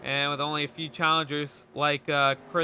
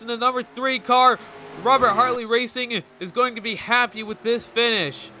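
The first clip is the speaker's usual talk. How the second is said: louder, higher final-lap call; excited race commentary; 5 semitones higher, 4 dB louder than usual. Acted. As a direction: build the excitement to the finish line.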